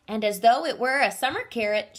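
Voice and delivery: ordinary delivery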